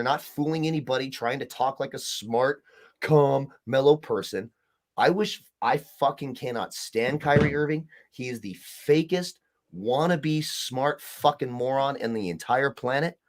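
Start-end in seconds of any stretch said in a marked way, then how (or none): none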